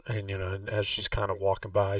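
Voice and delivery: normal talking voice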